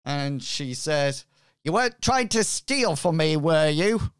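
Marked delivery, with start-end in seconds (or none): 1.6-4.1 raised, loud pirate captain. Acted. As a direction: boom it out like a ship's captain. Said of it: "my pirate voice".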